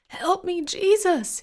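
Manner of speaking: high, loud scream for help; agonized wail. A narrator's reading. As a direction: wail in pain at the top of your lungs. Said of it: "wailing at the top of his lungs".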